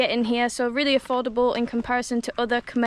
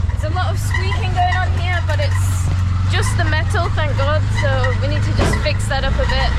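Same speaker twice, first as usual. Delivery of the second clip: raised voice over engine noise; loud; 7 dB louder than usual. Real, not acted. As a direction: speak up over the tuk-tuk.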